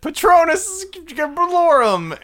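high, loud, drawn-out incantation; acted spell-casting. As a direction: cast a mock spell with a slow ringing cry. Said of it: "Then he, then he said".

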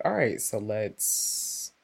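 low, soft, slowed-down steady voice; calm and collected. Retold or imitated. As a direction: talk everyone down coolly. Calm herself in a crisis.

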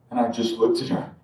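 usual speaking voice

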